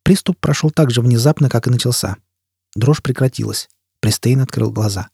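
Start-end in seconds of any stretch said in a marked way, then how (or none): none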